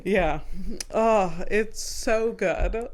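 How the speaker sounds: ordinary talk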